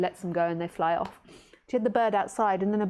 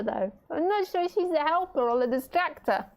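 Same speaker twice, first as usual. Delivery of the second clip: normal talking voice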